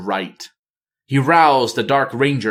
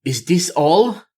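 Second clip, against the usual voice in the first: dismayed queen; 6 semitones higher than usual. Acted. Talanji sees her few soldiers.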